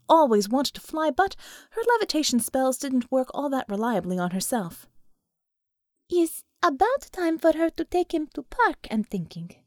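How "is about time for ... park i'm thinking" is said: put-on broken English, Russian accent; heavy Russian accent, higher pitched. Gamayun's broken English reply.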